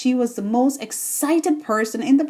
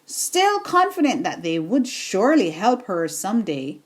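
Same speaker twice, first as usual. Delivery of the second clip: ordinary delivery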